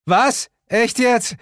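high, loud disbelieving call; as if caught off guard; surprised cry. A narrator's reading. Narrator cries out at Suko being alive, surprised. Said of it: "rief ich".

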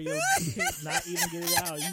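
high, teasing laughter; laughing. Real, not acted. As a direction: tease while giggling.

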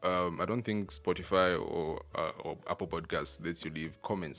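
loud, upbeat sponsor shout-out; ad read. Acted. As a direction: announce it like a radio ad. Podcast sponsor break segment.

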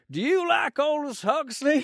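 high, loud, slow mock Southern drawl; mock Southern accent, drunk stranger quizzing him in a pub; drunk Southern American. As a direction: ham up the boozy Colonel asking a question. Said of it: "He was like the Colonel".

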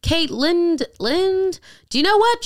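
high, exaggerated host voice mocking the name. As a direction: playfully mimic a clueless host stumbling on a name. Mocking the host's introduction.

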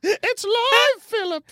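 put-on high, slow shrieking old queen; panicked Queen voice.

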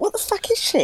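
baffled high squeak; mock bewildered squeal; imitating a puzzled host; confused face. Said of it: "You were like".

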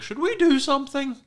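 comic imitated dithering; hesitant bystanders. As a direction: squeak it out as a useless onlooker. Voices hesitant bystanders standing around.

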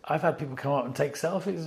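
ordinary delivery

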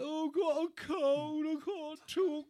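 high put-on croaky rock-star voice, claiming a cold. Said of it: "he's like".

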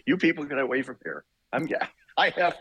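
high, laughing mock scold; a laughing, pretend get-away-from-here; mock shooing. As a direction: playfully shoo them off while laughing.

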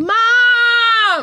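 very high loud yowl; shrill loud cat cry of mum; cat calling mum. Retold or imitated. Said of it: "Fucking mum".

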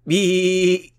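very loud, high sheep bleat. A narrator's reading. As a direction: bleat as loud as you can. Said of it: "bleated as loud as she could".

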